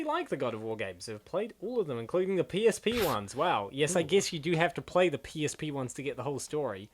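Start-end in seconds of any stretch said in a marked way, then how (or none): none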